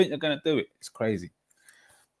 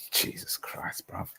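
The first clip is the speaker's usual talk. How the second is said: breathless disbelieving whisper; hushed "Jesus Christ"; stunned whisper; 4 semitones lower, 11 dB quieter, slower than usual. Real, not acted. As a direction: whisper it in shock. Stunned by a bad goal.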